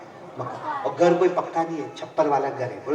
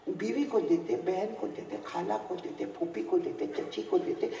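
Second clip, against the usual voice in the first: soft rebuke; 2 semitones higher, 10 dB quieter than usual. Acted. Prophet's gentle rebuke, fading to hush.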